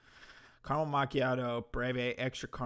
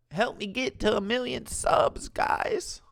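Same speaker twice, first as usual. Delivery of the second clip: high, pleading imitation of a channel owner; mock begging; 10 semitones higher, 5 dB louder than usual. Host mocking channel's plea for subscribers, high mock begging. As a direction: beg the viewers in a put-on high voice.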